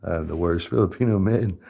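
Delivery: ordinary talk, nothing marked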